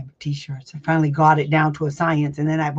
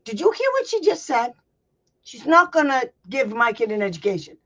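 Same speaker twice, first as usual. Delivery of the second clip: high, indignant appeal; indignant demo; 7 semitones higher than usual. Shown as a demonstration. Parent turning to the chairperson, indignantly calling her out. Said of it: "This is how you talk to them".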